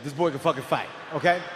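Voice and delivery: usual speaking voice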